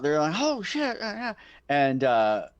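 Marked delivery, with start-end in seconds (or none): 0.4-1.3 high-pitched mock panic; freaking out. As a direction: voice shoots up, acting out startled hunters. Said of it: "yeah, they're freaking out".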